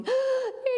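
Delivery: sharp, high gasp of delight. Retold or imitated. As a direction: gasp as if seeing something wonderful. Sees a person with Down syndrome.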